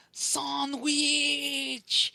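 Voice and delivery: high drawn-out mock cry; hammed-up, high-pitched hungry yell; big guy. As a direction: imitate the big guy wailing for food. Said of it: "All as I see is the big guy going".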